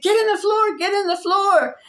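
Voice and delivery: put-on high, loud panicked yell to a child; frantic shout. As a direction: shout the warning like the bus is out of control. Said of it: "I just shouted out to my little son".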